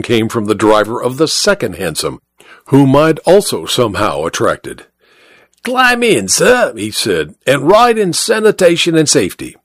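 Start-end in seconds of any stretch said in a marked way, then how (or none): 5.6-9.6 higher, ingratiating cabman's voice; touting cabby. Acted. Second cabby coaxes the passenger into his cab, higher and eager. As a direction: sell the ride, pitched up and eager. Rival cabby wooing the fare.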